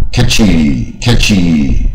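"catchy" with a heavy, drawn-out "chee"; slow "catchy" with the ending hit too hard. Shown as a demonstration. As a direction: overdo the final sound of "catchy". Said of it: "念太重".

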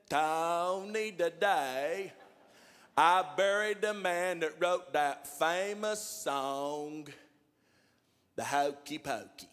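very slow, drawn-out caller; slow-talking John on the phone; John's slow talk. Retold or imitated. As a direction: stretch every word like a slow talker. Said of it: "John talks like that".

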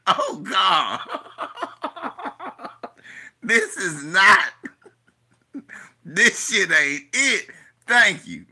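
loud, laughing mock despair; laughing exasperation. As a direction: beg her to stop, laughing. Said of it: "I'm like".

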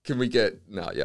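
normal talking voice

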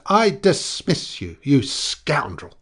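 slow, high, loud fury; indignant shriek, heavily drawn out; outraged dismissal. A narrator's reading. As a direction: draw out each word in outrage. Screamed quarrel continues.

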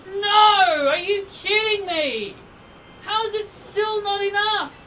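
high, loud cry of disbelief; outraged disbelief. Real, not acted. Gamer seeing the attack fall short, outraged disbelief. Attack fails after begging.

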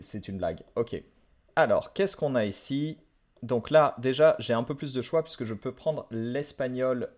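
ordinary talk, nothing marked

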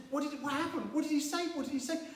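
high, puzzled crowd asking; mock-eager questions from the people; curious crowd. Crowd quizzes mute Zechariah, high puzzled voice. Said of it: "everyone said".

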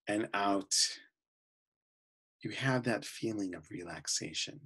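calm, unhurried voice with long pauses between phrases; guided meditation. Real, not acted. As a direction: guide them gently into relaxation, often softening at phrase ends.

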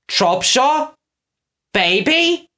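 high, rising astonishment; surprised question. Acted. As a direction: echo it in disbelief, lifting each word. Newcomer startled by the revelation.

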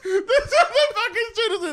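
high, loud, slow words through laughter; laughing. Real, not acted. Friend laughing, telling storyteller to keep it in. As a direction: gasp it out while laughing.

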